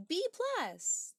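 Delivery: high cheerful exclamation; excited. Real, not acted. Cheers chat's comment.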